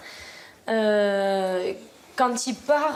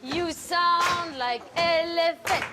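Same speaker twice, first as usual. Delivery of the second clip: high, slow teasing remark; teasing choir teacher; 6 semitones higher, much slower than usual. Real, not acted. Teacher teasing her choir, high and slow. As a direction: tease them for singing too heavily.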